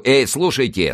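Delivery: ordinary delivery, nothing marked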